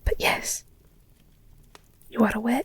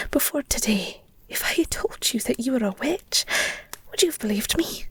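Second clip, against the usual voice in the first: usual speaking voice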